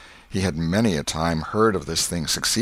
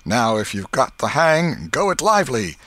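higher, louder, egging on; urging; 6 semitones higher, 5 dB louder than usual. A narrator's reading. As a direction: urge your partner on. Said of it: "Presently Tom said".

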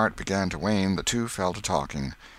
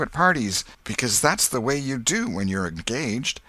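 higher, eager schoolboy explaining; self-assured boy Tom; 6 semitones higher, 4 dB louder than usual. Acted. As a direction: rattle off the rules eagerly. Tom explains engagement rules.

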